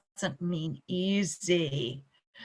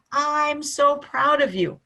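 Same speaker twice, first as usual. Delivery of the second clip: slow, high, louder praise; mock proud praise; gushing praise; 5 semitones higher, 6 dB louder, slower than usual. Shown as a demonstration. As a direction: lay on the praise thick. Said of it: "or praising them".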